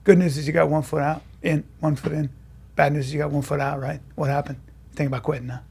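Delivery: soft, deadpan verdict; acting coach impression. Acting coach gives a student his verdict, quietly deadpan. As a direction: deliver it quietly and unhurried, low-key. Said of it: "He goes".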